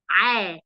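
عا said low and throaty with an open a; a teacher modeling عا for the class; ain with fatha. Shown as a demonstration. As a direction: sound out عا from the throat. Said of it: "صوته إيه؟".

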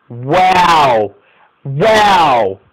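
loud, drawn-out wow of disbelief; loud amazement at a wonder goal; amazed wow. Real, not acted. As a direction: belt out an astonished wow. Reacts to long-range goal.

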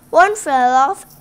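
plain reading voice

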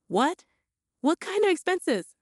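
high, insistent disbelief; pressing question. A narrator's reading. Daughter pressing mother about the spending, insistent. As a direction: push for an answer. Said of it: "I pressed".

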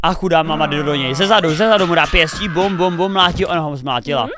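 usual speaking voice

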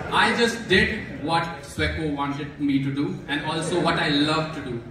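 ordinary talk, nothing marked

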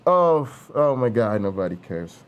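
low, fading bored groan. Pretend.

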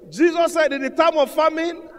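loud, high, insistent preaching voice; emphatic preaching. Real, not acted. Preacher urging congregation to practice a laugh, emphatic preaching.

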